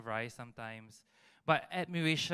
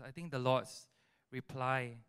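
ordinary talk, nothing marked

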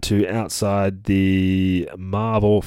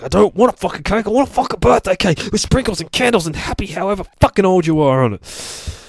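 high, loud ranting tantrum as a character; angry outburst; 11 semitones higher, 4 dB louder than usual. Acted. Gabby demanding a proper birthday cake, acted angry outburst. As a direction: rant it high and loud, easing down at the end. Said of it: "fucking gets all angry".

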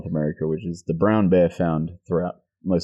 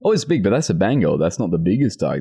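ordinary talk

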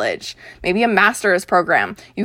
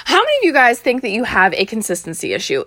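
normal talking voice

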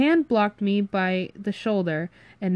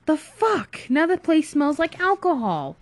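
high-pitched, indignant outburst; exasperated outburst; 9 semitones higher, 4 dB louder than usual. Acted. Friend to friend over spilled beer, exasperated. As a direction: protest in a raised, exasperated voice.

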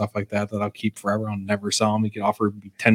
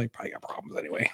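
muttered aside; 15 dB quieter, faster than usual. Real, not acted.

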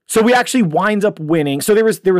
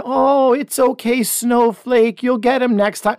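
high, sugary coddling voice, mocking; mock nice coach; 6 semitones higher, slower than usual. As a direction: talk down sweetly to a crying kid. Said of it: "coaches their kids nicely".

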